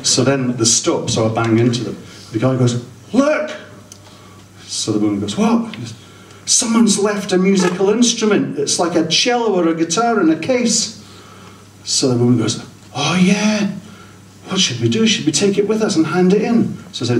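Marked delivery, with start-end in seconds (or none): 3.1-5.8 pitched-up startled exclaim; high surprised cry. Retold or imitated. Passenger spotting a guitar, startled and high. 6.4-16.8 pitched-up busybody couple, fretting; high fussy passengers. Retold or imitated. Couple finding a guitar, in a high fussy voice.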